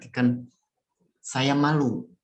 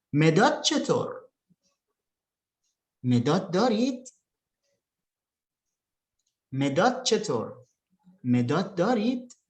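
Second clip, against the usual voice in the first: "مداد چطور؟" falling, then "مداد دارید؟" rising high on "دارید"; "مداد دارید؟" with a clear questioning lift at the end; question intonation; 4 semitones higher than usual. Shown as a demonstration. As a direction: model both questions, letting "چطور" fall and lifting "دارید". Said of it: "Perhatikan intonasinya juga ya".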